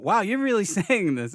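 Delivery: laughing, high, amazed; half-laughing at yourself; laughing disbelief. Retold or imitated. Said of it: "I was even going".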